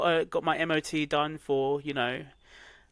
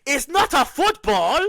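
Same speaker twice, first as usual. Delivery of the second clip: imitating the doctor in a thick African accent; high, loud, slow African accent; 11 semitones higher, 6 dB louder than usual. Doctor tells a patient it's not a football, thick African accent. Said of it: "In his full, thick African accent".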